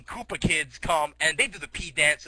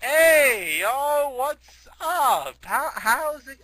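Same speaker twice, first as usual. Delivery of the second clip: high, loud, hammed-up hello; boisterous greeting; 7 semitones higher, 5 dB louder, much slower than usual. Shag greeting Mac in Canada, high boisterous voice. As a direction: play an over-eager buddy hailing a friend.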